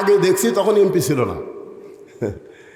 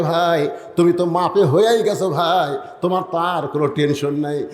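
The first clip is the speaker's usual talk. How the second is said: usual speaking voice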